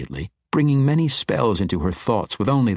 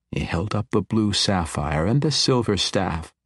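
ordinary delivery

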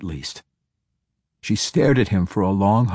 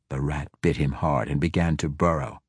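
plain reading voice

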